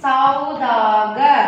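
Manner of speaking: saudagar drawn out very slowly; slow, careful classroom model of saudagar; slow teaching read. Shown as a demonstration. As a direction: stretch out each syllable of saudagar, sau-da-gar. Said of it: "Here with sa we are using okay matra".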